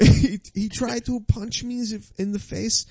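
high foreign fighter, Swedish-tinged; mock Swedish accent. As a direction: imitate an earnest accented fighter telling his story. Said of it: "like, a Swedish guy".

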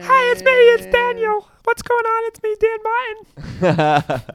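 squeaky falsetto mockery; high squeaky mock voice; impression of Dan. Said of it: "this is my impression of Dan".

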